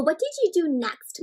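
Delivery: ordinary delivery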